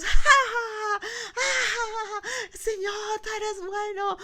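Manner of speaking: very high, whooping cry of praise; joyful shouting. Acted. Pastor acting the others shouting for joy, joyful shouts. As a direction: burst out loud, then hold it high. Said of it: "los otros estaban dando gritos".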